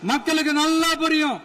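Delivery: high-pitched, insistent political oratory. Real, not acted. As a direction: project it high so opponents hear. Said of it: "நான் ஏன் கொஞ்சம் சவுண்டா பேசுறேன்".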